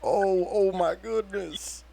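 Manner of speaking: theatrical damsel moaning about her back; fake pained cry. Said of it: "and I say".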